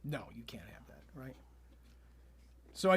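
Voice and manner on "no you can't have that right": hushed conspiratorial whisper. Acted. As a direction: whisper it like a secret. Mock conspiratorial agreement.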